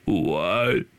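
very low croak spoken on an in-breath; attempted villain impression, sucked in and creaky; inhaled bad-guy voice. Shown as a demonstration. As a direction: breathe in while talking, eerie game villain. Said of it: "I'll try to demonstrate it".

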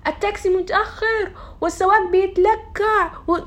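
high, rushed imitation of an anxious friend; mimicking stressed friend. Imitating her anxious friend complaining about the late taxi, high and agitated. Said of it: "كانت بتطالع فيا تقول لي".

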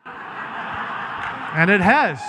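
slow, laughing through the words. Real, not acted. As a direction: chuckle it out at your own joke. Laughs at his own joke.

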